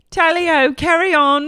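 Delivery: high, put-on British cheer; mock British. Co-host riffing on Meredith, high mock British accent. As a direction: play along in cartoon British. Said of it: "goes back into a British accent".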